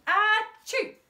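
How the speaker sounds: high, loud explosive sneeze; a huge sneeze. Acted. Another sneeze at table.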